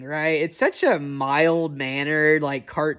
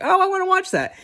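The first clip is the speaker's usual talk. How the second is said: eager; faster than usual. Retold or imitated. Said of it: "It's like".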